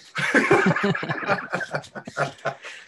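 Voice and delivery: long, high cackling laugh; real burst of laughter at your own story; hearty laugh. Real, not acted. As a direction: crack up helplessly.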